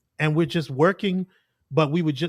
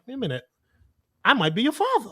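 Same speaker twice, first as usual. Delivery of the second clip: mock realization; 8 semitones higher, faster than usual. Joking father's realization.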